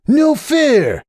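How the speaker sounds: high indignant yell. A narrator's reading. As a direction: yell in protest. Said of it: "he yelled".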